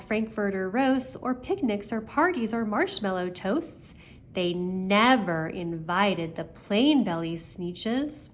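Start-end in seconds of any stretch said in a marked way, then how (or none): none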